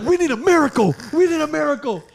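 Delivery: high, loud desperate rallying cry; hyped plea. Retold or imitated. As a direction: beg for it at the top of your voice. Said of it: "I'm getting hyped".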